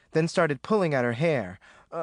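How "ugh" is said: long drawn-out wail; anguished wail. A narrator's reading. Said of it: "She slapped herself against the face".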